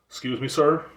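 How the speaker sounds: puzzled customer. Retold or imitated. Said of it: "They're like".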